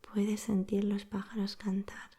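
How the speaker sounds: ordinary delivery, nothing marked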